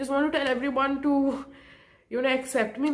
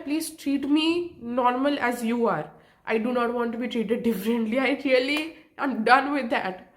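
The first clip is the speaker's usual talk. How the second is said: normal talking voice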